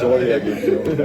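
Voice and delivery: loud, laughing sorry; hammed-up apology with a big laugh; laughing mock apology. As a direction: laugh through a jokey apology. Jokes about apologising to fans.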